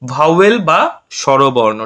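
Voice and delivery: teacher slowing down to introduce a term; slow teaching stress. Shown as a demonstration. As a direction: lay out vowel বা স্বরবর্ণ word by word for the class. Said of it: "এটা হচ্ছে তোমার".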